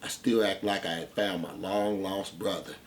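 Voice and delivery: ordinary talk, nothing marked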